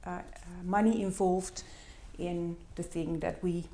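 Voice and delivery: ordinary talk, nothing marked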